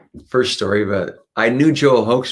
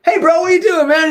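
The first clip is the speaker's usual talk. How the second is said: voicing Joel; 7 dB louder than usual. Joel voiced in retold scene.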